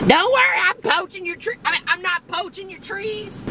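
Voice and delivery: very high, squeaky call; mock reassurance. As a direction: call out an apology in a falsetto squeal. Mock calling to tree owners.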